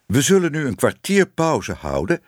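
a hint of a formal speaker; lightly voiced lecturer. A narrator's reading. Lecturer announces the interval to his audience, lightly voiced. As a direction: announce it to a hall, lightly in role. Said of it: "zei meneer Smit nadat hij een uur had gesproken".

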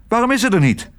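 high, agitated demand; insistent high-pitched exclamation; calling out. A narrator's reading. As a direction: cry out the question, pitched high. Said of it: "riep Tos".

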